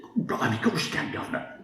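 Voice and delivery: soft, light mock Cockney accent; a quiet hint of fake Cockney. Tim Hortons lady teasing his accent, soft mock Cockney. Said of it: "just mocking my accent".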